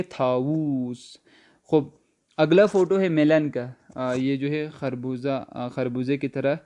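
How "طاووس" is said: طاووس stretched slowly; teacher drags out طاووس; slow Persian model. Shown as a demonstration. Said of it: "مور کو ہم کیا پڑھیں گے؟".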